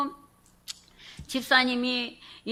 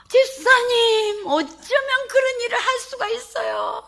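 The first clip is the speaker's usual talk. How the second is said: marvelling at the deaconess; amazed question; 13 semitones higher, 5 dB louder than usual. Retold or imitated. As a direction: gasp it out in disbelief. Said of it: "이렇게 제가 막 물었어요".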